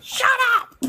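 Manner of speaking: high playful shout of shut up; mock shout. Telling her best friend to hush, high mock shout. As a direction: yell it up high, teasing.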